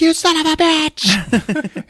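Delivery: mock furious shout. Jane shrieking at her husband, mock furious. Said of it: "She's like".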